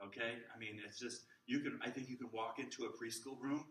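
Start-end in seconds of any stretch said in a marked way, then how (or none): none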